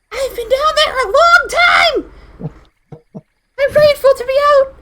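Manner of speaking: very high, loud squeal; creature voice. Acted. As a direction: shout it in a tiny shrieky creature voice. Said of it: "it will say".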